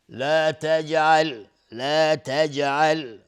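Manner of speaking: لا تجعل with the ج bouncing with an echo; qalqala on jim. Shown as a demonstration. Teacher drilling the echoing letter for students. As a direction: make the ج of تجعل rebound.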